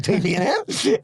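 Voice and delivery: lightly voiced secretive reply, pitched high; quoted high aside. Telling the governor it stays between them, pitched high.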